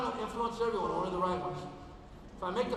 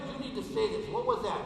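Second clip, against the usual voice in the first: normal talking voice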